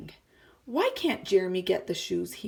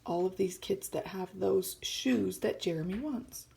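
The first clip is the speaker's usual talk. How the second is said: usual speaking voice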